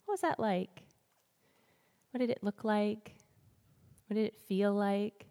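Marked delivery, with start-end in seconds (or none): none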